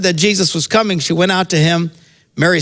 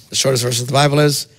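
ordinary talk

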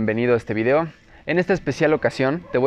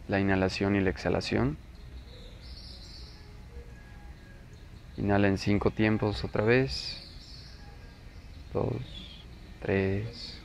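slow, softer, lower meditation voice with long pauses; guided meditation; 3 semitones lower, 11 dB quieter, slower than usual. Acted. Teacher leading listeners into breath awareness, guided meditation. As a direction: guide the listener unhurriedly through breath and body.

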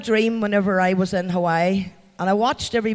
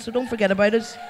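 usual speaking voice

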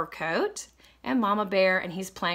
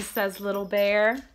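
ordinary delivery

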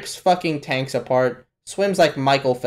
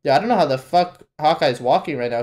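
usual speaking voice

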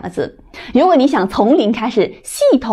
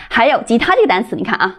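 ordinary talk

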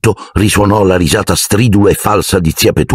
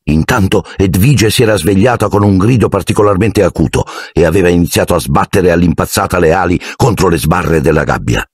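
plain reading voice

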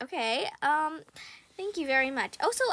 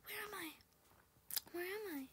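hushed confusion; 22 dB quieter than usual. Real, not acted. Confused after dying.

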